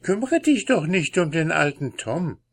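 impatient wife. Acted. Wife urges husband on.

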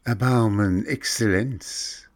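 final hopeful plea; pleading. A narrator's reading. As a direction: flatter him in a plea. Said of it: "weinte und flehte".

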